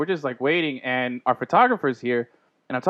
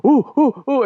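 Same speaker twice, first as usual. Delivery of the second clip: giddy fanboy; 10 semitones higher, 6 dB louder than usual. Retold or imitated. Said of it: "Gus is like".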